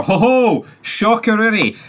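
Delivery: high, hammed-up gasp of surprise; mock shock. Vlogger mocking the show's twist, hammed-up high surprise. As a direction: play the twist as a fake shocker.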